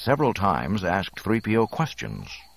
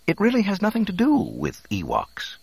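high-pitched robot interpreter; droid explaining. A narrator's reading. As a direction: break the refusal as a fussy droid. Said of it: "the droid explained".

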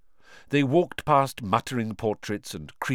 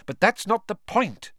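impatient; 12 semitones higher, 3 dB louder than usual. A narrator's reading. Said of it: "said Hermione impatiently".

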